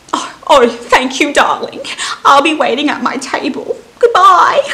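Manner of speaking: high, loud, hammed-up posh old lady; theatrical posh customer ordering coffee, high and loud. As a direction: play a grand fussy customer calling everyone dearie. Customer thanks barista in skit.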